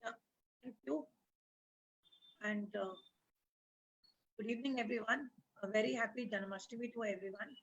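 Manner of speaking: faint, far from the mic; barely audible. Real, not acted. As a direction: volume far too low. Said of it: "Your voice is very low".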